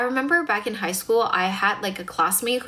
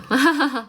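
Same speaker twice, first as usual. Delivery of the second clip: short bright laugh, a bit louder; amused laugh; 5 semitones higher, 4 dB louder than usual. Real, not acted. Streamer laughing after teasing a viewer, short bright laugh. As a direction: laugh it off at your own joke.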